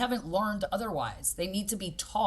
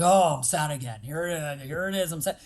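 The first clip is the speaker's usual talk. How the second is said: lower, dragging mopey sigh of a voice; mock heavy sadness; 3 semitones lower than usual. Coach mocking how people dwell on sadness, mock mopey. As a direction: ham up wallowing in being sad. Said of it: "So when we weigh on it".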